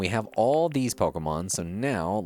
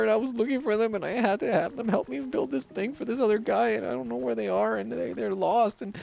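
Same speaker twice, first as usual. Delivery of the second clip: high-pitched, pleading lost-friend act; lost friend-seeker voice; 11 semitones higher than usual. Pretend. Player begs for help finding a lost friend, high mock-distressed voice. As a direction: plead helplessly in a high put-on voice. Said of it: "What was that voice".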